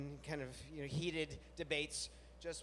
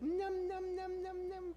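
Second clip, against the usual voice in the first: much higher playful nom nom; fake eating noises; slower than usual. Coaxing the anxious dog to eat, much higher playful munching. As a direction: pretend to relish dog food. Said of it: "So I'd be like".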